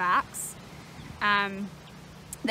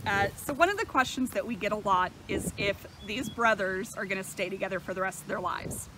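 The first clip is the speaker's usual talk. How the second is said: normal talking voice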